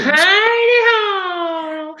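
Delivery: very high, long drawn-out squeal; cartoon call in a high falsetto, stretched out; Mister Hanky. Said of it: "I can't remember how Mister Hanky sounds".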